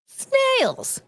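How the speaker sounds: snails shrieked very high; disgusted squeal. Real, not acted. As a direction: squeal the word in mock horror. Mocking snail eaters.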